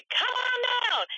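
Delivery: hammed-up radio announcer stretching every word; drawn-out announcer. Shown as a demonstration. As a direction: draw out "Come on down" like a radio host.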